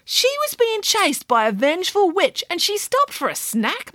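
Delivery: put-on high, amazed boy voice; disbelieving child character; incredulous boy. A narrator's reading. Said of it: "marvelled Derek".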